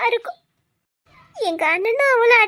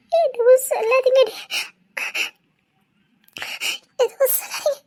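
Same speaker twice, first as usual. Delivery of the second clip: crying; 4 semitones higher than usual. Acted. Tearful plea at wedding.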